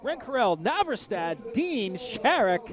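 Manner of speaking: loud, drawn-out race-announcer roll call; announcer lineup. Acted. Commentator announcing the heat one riders to the crowd, in a loud drawn-out call.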